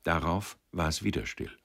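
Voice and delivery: ordinary delivery, nothing marked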